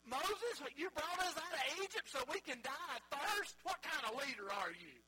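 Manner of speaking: loud, high mock accusation; hammed-up angry crowd challenging its leader; crying out in complaint. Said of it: "they're crying out against God".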